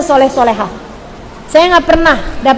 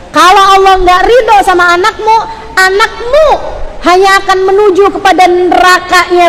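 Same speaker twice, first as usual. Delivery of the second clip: raised, insistent warning sermon; forceful preaching; 4 semitones higher than usual. Real, not acted. Preacher warns mothers of hell, raised and insistent. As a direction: build the warning at high pitch, hammering the repeats.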